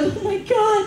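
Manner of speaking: high-pitched shocked imitation; mock horror. Onlookers reacting to the bite, high mock horror. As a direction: gasp it out high, hammed-up.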